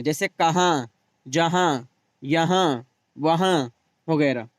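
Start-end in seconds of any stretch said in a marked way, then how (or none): 0.3-3.7 final vowel through the nose in "कहाँ, जहाँ"; nasal ending on each of "कहाँ, जहाँ, यहाँ, वहाँ"; nasalized noon ghunna. Shown as a demonstration. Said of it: "जो नून नाक में पढ़ा जाए".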